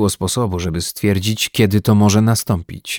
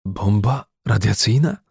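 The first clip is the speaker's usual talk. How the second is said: shocked, halting question; stammering. A narrator's reading. Dorm manager learns of the bomb, stammering. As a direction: falter over the words, rising at the end. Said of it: "wyjąkał kierownik".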